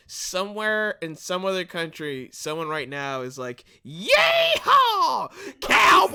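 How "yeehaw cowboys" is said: high, loud hammed-up cowboy holler. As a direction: whoop like a bad cowboy. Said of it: "shitty cowboy voice".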